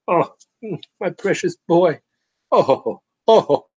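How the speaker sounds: doting coo. Real, not acted. Cooing to his beagle.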